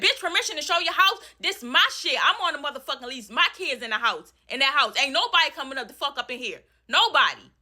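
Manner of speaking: high, scornful angry yell; furious yelling. Retold or imitated. Tenant answers landlord about the lease, high scornful yell. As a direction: rip into her. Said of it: "I said".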